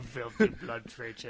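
house elf muttering. Acted. Echoing the elf's insult, slow low mutter.

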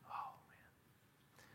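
breathy whispered sigh of awe; moved hushed exclamation; awed whisper. Real, not acted. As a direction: whisper it, overcome. Moved after hymn lines.

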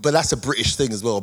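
usual speaking voice

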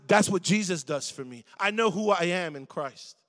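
normal talking voice

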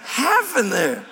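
very high, slow, breathy-whispered what-the-heck; mock-shocked squeak; squeaky disbelief. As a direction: squeal at a limp handshake. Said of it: "I'm like".

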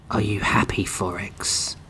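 icy breathy whisper; cold hissing layered whisper. Acted. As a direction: whisper it coldly, like a wraith. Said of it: "the Wraith whispered".

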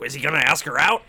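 put-on old men buzzing, fast and loud; nosy old poker players wondering if he'll ask her out, excited; eager gossip. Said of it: "They're like".

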